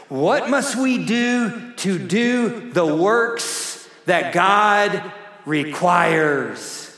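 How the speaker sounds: the disciples' question, stress on "requires". Retold or imitated. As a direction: hit the word "requires" as the disciples ask. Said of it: "Emphasis on the word requires".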